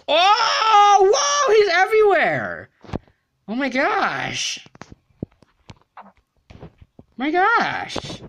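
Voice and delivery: very high, loud fearful cries; high shrieking panic; panicked screams. Real, not acted. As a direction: scream as he chases you. Chased in game.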